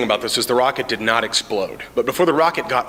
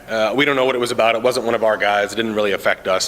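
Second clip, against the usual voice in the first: ordinary talk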